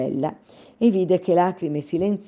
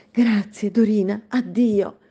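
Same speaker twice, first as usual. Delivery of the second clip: grateful princess; 5 semitones higher, 3 dB louder than usual. Acted. Princess bids farewell.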